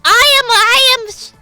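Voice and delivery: ordinary delivery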